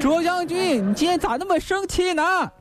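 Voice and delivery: high-pitched puzzled appeal; 秦总管喊话. Acted. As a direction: plead up to the angry general. 秦总管向城上将军喊话. (高声不解地问将军)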